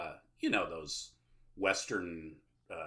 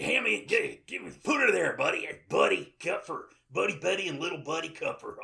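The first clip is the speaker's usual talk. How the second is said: brash tourist dad; 8 semitones higher, 6 dB louder than usual. Acted.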